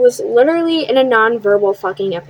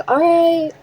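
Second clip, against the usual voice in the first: higher, put-on cheerful agreement; fake happy; 7 semitones higher than usual. Agreeing to her ex's offer, she fakes being cheerful. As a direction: pretend to be fine with it. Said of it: "Trying to act all happy about it".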